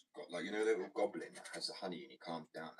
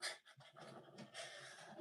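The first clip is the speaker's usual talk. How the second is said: quiet giggle; 11 dB quieter than usual. Real, not acted.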